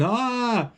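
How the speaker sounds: high, thin playful yell; kids yelling. Retold or imitated. Retelling boys yelling over the ridge, high playful yell. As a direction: toss off a short kids' "Ah!" from the cliff. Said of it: "They're, they're yelling".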